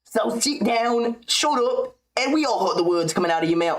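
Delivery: high put-on voice, aiming for rasp; high mocking scold with attempted rasp; Ian Gary impression. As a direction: try a raspier squeaky put-down. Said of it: "I'll try to get more raspy with it".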